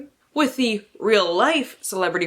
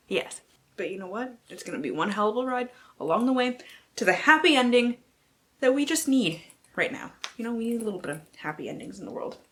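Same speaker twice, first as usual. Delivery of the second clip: normal talking voice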